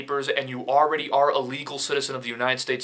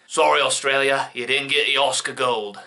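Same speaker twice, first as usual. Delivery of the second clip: hammed-up, badly faked Aussie accent; bad Australian accent. Consoling Australia over the lost Oscar, bad Australian accent. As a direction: play a mock-Australian mate saying sorry. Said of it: "with my bad accent".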